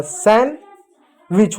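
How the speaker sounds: सैंडविच slowly as san, wich; silent D. Shown as a demonstration. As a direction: split सैंडविच slowly, without the d. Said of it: "सैंडविच होता है". (डी बिना, धीरे "सैंडविच")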